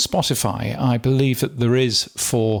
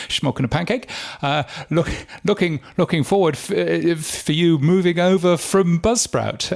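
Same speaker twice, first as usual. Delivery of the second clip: put-on Dutch accent; 5 semitones higher than usual. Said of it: "Dutch accent".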